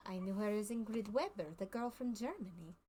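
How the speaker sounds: put-on lightly French-accented circus showman. Circus man to the player, explaining his put-on accent in light French accent. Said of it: "I can do French".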